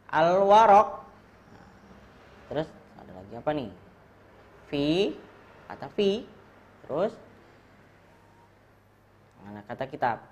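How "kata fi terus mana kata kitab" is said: ordinary talk, nothing marked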